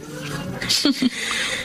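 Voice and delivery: low, soft mocking laugh; scornful laugh. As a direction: scoff at their drama with a laugh. Scoffing at in-laws' excuses. (mapanuyang tawa)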